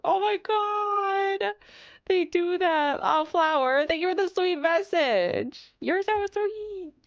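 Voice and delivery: high, slow cooing voice; touched cooing. Real, not acted. Streamer thanks viewer Flower for a sweet message, cooing. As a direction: coo your thanks.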